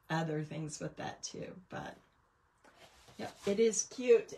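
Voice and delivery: normal talking voice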